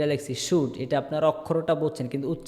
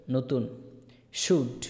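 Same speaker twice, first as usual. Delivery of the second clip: usual speaking voice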